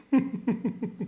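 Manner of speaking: laugh. Real, not acted. Mocking the rival's smile.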